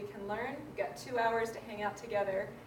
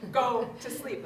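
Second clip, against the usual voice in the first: louder, firm bedtime order; coaxing mom turns firm; firm command; 4 semitones higher, 9 dB louder than usual. Retold or imitated. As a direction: tell a toddler to sleep, no nonsense. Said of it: "I'm like".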